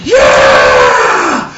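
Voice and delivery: loud, long roaring yell; acted cheer. Father and son celebrating, hammed-up roaring cheer. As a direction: cheer like a fan at a win. Acts Yahweh and Yehoshua cheering.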